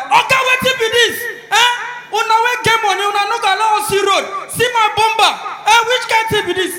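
high, loud protest at the rich man; angry complaint. Acted. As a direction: shout about the smashed bumper. Angry driver after car crash.

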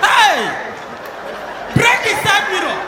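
loud high cry of triumph; loud gleeful whoop; excited yell. Acted. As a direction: shout as you smash it. Driver smashing mirror.

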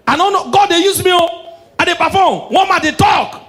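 high, loud exclamations; excited shouting. Acted. Boasting of performing, acted at full voice.

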